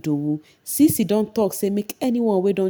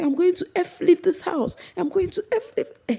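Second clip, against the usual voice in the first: very high mock yell; high-pitched retold outburst; mock shouting; 12 semitones higher than usual. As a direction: imitate her shrieking threat to leave. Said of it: "she was using the F and the B words".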